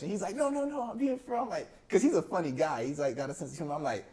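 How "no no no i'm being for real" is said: high-pitched, imitated insistence; retold earnest denial, voice raised high; insistent protest. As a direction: protest that you really mean it, pitch jumping up. Uncle insists he isn't joking.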